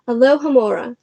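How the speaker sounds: ordinary delivery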